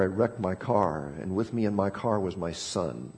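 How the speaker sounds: a father breaking the news of his son's death; hushed, sorrowful confession; grieving doctor. Acted. Doctor confesses losing his son.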